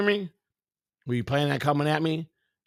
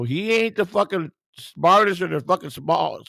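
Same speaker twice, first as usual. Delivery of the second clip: ordinary talk, nothing marked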